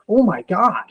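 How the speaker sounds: mild, imitated astonishment at a rare sight; amazed. Lady reacting to a patient's huge aneurysm, amazed.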